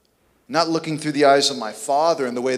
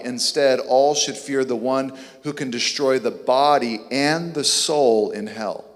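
normal talking voice

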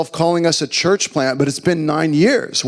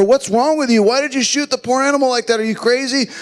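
mock shout; 9 semitones higher than usual. Husband protesting to his wife over the shot horse, mock shout. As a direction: fire off horrified questions in a raised pitch. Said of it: "I shouted to her".

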